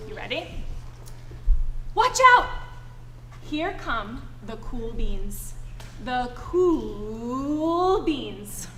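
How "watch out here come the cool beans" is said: ordinary talk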